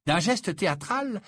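ordinary delivery

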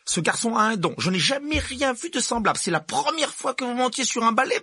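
high-pitched strict teacher, firm and proud; teacher presenting a gifted pupil, strict and pleased; stern professor McGonagall. Acted. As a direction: play the brisk headmistress type. Voicing McGonagall, much higher.

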